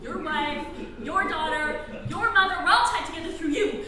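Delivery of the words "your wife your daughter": ordinary delivery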